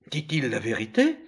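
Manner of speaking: ordinary delivery, nothing marked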